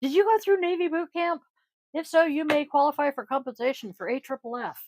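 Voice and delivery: high mock TV ad pitch; lawyer ad announcer. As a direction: read it like a lawsuit ad. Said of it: "T V announcer voice".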